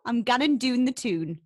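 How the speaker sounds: loud, broad Geordie; Geordie accent. Shown as a demonstration. Geordie host demonstrating for the guest again, broad Geordie accent. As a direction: model the Geordie vowels in "doon" and "tune". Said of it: "Go on, you have a go".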